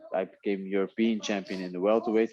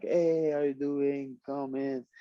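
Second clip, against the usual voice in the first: higher, slow, open-armed greeting; friendly Dutch welcome; 7 semitones higher, slower than usual. Retold or imitated. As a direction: lightly imitate a cheery Dutch host. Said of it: "Dutch people are like".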